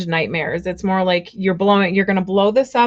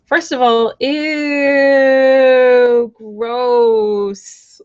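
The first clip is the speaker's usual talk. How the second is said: disgusted; 4 semitones higher, 5 dB louder, much slower than usual. Real, not acted. Disgust at dating sister's ex.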